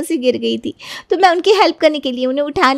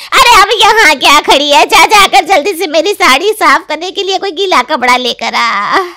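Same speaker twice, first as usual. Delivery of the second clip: loud, high furious scolding; angry scolding; 5 semitones higher, 6 dB louder than usual. Acted. Aunt-in-law scolds the bride for spilling tea, furious and loud. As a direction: scold the new bride hotly.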